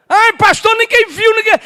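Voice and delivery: high, loud mock whine of a church member; high-pitched mocking imitation; mimicked excuse-maker. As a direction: imitate someone making excuses to the pastor.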